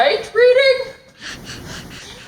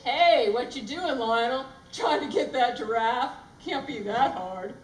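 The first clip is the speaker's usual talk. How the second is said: softer, lower lion voice; other lion; 5 semitones lower, 9 dB quieter than usual. Acted. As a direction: drop low for a second lion. New character, another lion.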